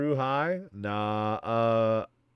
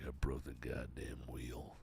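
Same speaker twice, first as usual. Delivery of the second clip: much lower and softer, slow gruff line; low Arthur Morgan take; 11 semitones lower, 16 dB quieter, slower than usual. Retold or imitated. Streamer testing a second way Arthur Morgan says it, low and soft. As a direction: drop low and quiet, weary outlaw. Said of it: "Or does he say, like".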